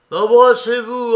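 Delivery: very loud, high eager cry; loud urging. A narrator's reading. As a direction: shout it out, urging them on. Dit le tzigane.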